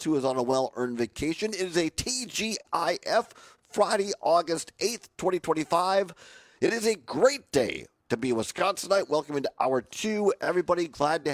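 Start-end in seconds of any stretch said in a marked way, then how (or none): none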